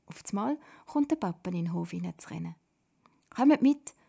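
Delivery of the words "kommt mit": high, loud summons; very excited call. A narrator's reading. Papa running into the yard, calling excitedly. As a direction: call them over urgently. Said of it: "ruft er ganz aufgeregt".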